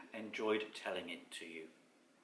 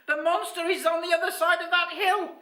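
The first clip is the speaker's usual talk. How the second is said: very high, loud squeaky creature; piercing little storybook voice; squeaking; 9 dB louder than usual. A narrator's reading. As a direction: squeak the news urgently. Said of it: "squeaked Basil suddenly".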